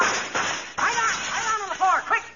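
high, urgent panicked orders; panicked alarm. Acted. Husband warns wife of gunfire, panicked high alarm. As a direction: yell them to the floor in a panic. Thinks the spy is shooting.

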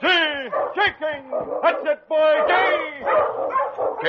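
high, loud turn command; ringing musher's call; cried out. Acted. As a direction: sing out the gee over the wind. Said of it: "Sergeant Preston cried out".

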